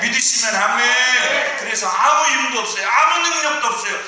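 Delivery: loud, high shouted sermon; preaching at full force. Acted. Pastor urging the congregation to cry out, shouting high. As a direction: thunder it out to the congregation.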